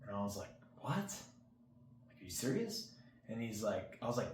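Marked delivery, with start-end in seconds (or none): 0.8-2.9 breathy gasp, then a high incredulous question; mock stunned, pitch jumping up; retold disbelief. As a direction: replay the shock of hearing it. Stunned reaction to a PC offer.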